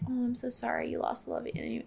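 soft, offhand mock condolence; imitating breezy, casual sympathy. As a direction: toss off the apology lightly. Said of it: "it just felt so casual for her".

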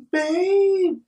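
high, drawn-out mock plea; high-pitched girlfriend impression, calling. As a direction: imitate a needy call for help. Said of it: "She's still calling".

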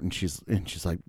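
usual speaking voice

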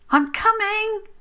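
high, loud eager call; bright answering call; calling back. A narrator's reading. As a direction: shout back to someone far off. Child calls back to mother.

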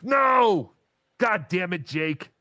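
high-pitched cry of protest; exasperated, high outburst; outraged disbelief. Real, not acted. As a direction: yelp it in furious disbelief. Bad news about losing the bet.

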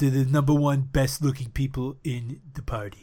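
Trump impression. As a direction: brag like Trump about the best people. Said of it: "That's my Trump impression".

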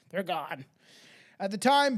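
usual speaking voice

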